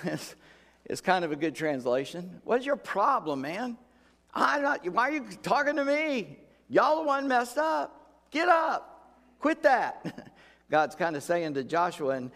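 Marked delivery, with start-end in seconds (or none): 2.4-10.3 God scolding. Acted. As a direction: shout at him, fed up with his moping. Preacher voicing God scolding Joshua.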